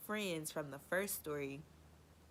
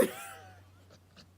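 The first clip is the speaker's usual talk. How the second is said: airy squeal of laughter; breathless high laugh; 7 dB quieter than usual. Real, not acted. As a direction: wheeze out a high silent laugh. Laugh after cousin's line.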